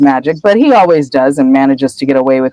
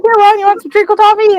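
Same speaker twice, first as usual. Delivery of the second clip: silly Hagrid voice; faster than usual. Retold or imitated. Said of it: "He's like".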